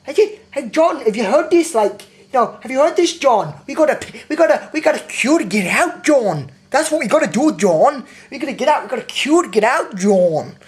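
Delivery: mocking honking woman's voice, pitched high; high, loud, swooping caricature of a nagging talker; horn-like talker. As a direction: blare it out like a horn in someone's face. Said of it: "putting a horn in someone's face, and that's how she talks".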